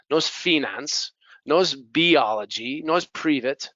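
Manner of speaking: usual speaking voice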